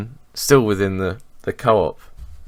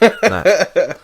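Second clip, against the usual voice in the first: laughing; 11 semitones higher, 5 dB louder than usual. Real, not acted. Laughing at himself.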